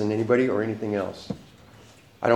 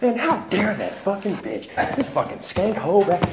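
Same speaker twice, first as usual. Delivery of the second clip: higher, slow mock furious rant; retold angry rant; 9 semitones higher, slower than usual. Venting to his buddy in the car about her, slow mock fury. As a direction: spit it out like you're still seething, but replaying it. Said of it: "You, you don't never talk like that".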